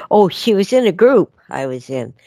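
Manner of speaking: usual speaking voice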